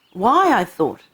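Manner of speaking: usual speaking voice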